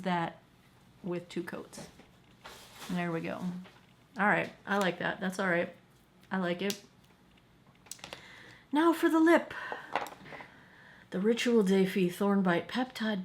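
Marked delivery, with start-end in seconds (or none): none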